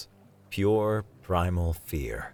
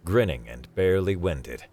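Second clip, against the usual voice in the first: plain reading voice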